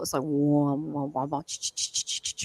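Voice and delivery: low, slow warbling wah and hissing ch sounds; imitated transmission. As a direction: mimic a strange alien signal. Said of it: "I got a transmission".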